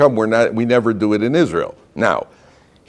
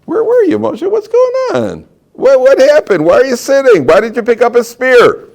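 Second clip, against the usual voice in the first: high, loud incredulous scolding; taking to task; 14 semitones higher, 6 dB louder than usual. Acted. Critics take Moshe to task for inaction, scolding. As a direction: demand answers in a high-pitched acted outcry. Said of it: "Moshe is taken to task for it".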